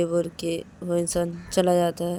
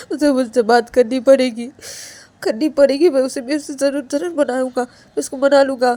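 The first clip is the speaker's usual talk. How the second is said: higher, insistent protest with stammered repeats; distraught, stubborn Ananya; 6 semitones higher, 7 dB louder than usual. Acted. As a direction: refuse frantically, words tumbling out. Ananya refuses to leave. (ज़िद में घबराया, ऊँची आवाज़ में इनकार)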